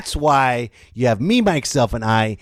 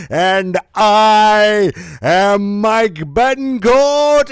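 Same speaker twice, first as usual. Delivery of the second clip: slow, loud, high host intro; slow booming show-host welcome; announcer welcome; 6 semitones higher, 4 dB louder, much slower than usual. Acted. Big announcer-style show opening.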